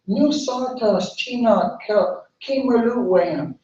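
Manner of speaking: a vain tree answering coolly; haughty dogwood tree. Acted.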